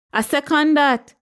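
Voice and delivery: high-pitched called-out agreement; shouted. A narrator's reading. Aunt backing Patricia as she advances, shouting. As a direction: pipe up high in a narrated shout. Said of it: "shouted Aunt Perlita".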